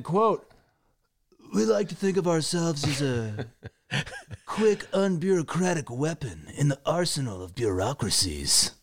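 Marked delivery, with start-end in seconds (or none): 1.5-8.7 put-on lightly done Spicoli surfer accent; Sean Penn Spicoli accent. Reading Sean Penn quote to host, light Spicoli surfer accent. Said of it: "You were doing your Sean Penn accent".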